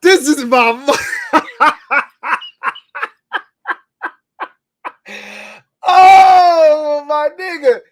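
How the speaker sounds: high laughing wail; losing it with laughter; crying laughing. Real, not acted. Cry-laughing at the joke.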